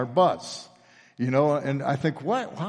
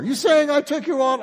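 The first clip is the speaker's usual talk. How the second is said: sharp, much higher indignant retort; offended roommate; 13 semitones higher, 5 dB louder than usual. Retold or imitated. Dripping-wet roommate accused of theft, high indignant retort. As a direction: fire it back like you've just been accused.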